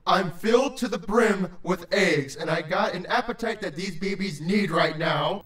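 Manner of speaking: low, impatient creature voice; deep-voiced, hungry grump; stern, bloated creature. A narrator's reading. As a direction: demand it irritably in a deep voice. Creature explains in story.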